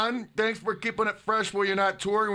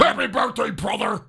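higher, louder Hulk Hogan growl; 4 semitones higher, 3 dB louder than usual. Pretend. Birthday shout-out to a fan's dad as Hogan. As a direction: bellow it like a pumped-up wrestler. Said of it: "in your best Hogan impression".

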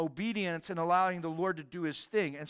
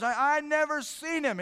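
heated denial; 7 semitones higher, 5 dB louder than usual. Retold or imitated. Peter denies knowing Jesus.

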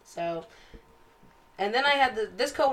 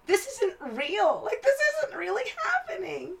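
disbelief; 11 semitones higher than usual. Retold or imitated. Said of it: "So I was like".

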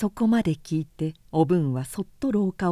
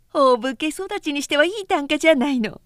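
sarcastic; 6 semitones higher, 4 dB louder than usual. Acted. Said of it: "おけいが皮肉に言った".

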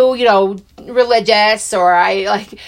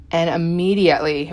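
ordinary talk, nothing marked

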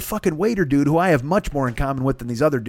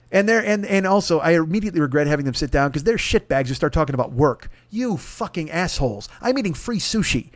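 ordinary talk, nothing marked

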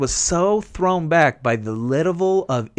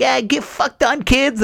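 higher, sneering taunt; taunting kid gamer; 10 semitones higher than usual. Retold or imitated. Twelve-year-old taunting losers, high sneering jeer. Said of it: "twat gets on like".

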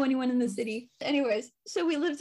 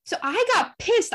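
higher, louder "pissed"; heated; 10 semitones higher, 5 dB louder than usual. Real, not acted. Telling a friend how angry she got. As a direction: let the anger flare up as you say it. Anger retold.

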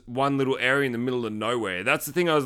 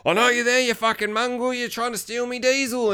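high-pitched hillbilly accusing a trespasser; mock an irate landowner, pitched up; hillbilly catching a thief, voice raised high; angry hillbilly; 8 semitones higher than usual. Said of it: "the hillbilly from earlier with the shotgun".

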